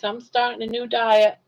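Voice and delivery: ordinary talk, nothing marked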